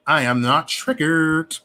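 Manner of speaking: slow, stretching "triggered"; slow, deliberate insistence; emphatic denial. Real, not acted. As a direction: draw out "I am not triggered". Repeats denial slowly.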